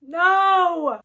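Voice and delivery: loud, high cry of protest; horrified no; shouted no. Real, not acted. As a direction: yell it in horror. Reacting to the villain's plan.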